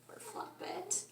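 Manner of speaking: soft whispered sympathy; whispered pity. A narrator's reading. Narrator pities the sad bunny in a whisper. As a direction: sigh it out, barely voiced.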